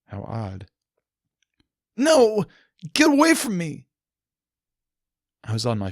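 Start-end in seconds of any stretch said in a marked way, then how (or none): 2.0-3.9 loud, high terrified yelling; frantic cry to flee; panicked shout. Acted. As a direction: scream her away in panic. Panicked refusal to Celestia.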